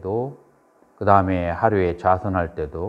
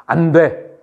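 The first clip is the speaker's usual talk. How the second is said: loud, raised-pitch strict "no"; stern refusal; 6 semitones higher, 5 dB louder, slower than usual. Acted. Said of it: "엄하게 하는 것으로서". (엄하게 잘라 말하는 거절)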